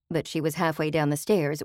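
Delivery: plain reading voice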